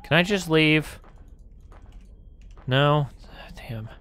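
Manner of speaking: normal talking voice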